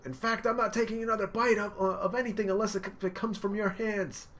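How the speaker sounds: high, loud emotional yelling; impassioned shouting. Retold or imitated. Luffy yelling that Sanji is lying. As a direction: call him out, all heart.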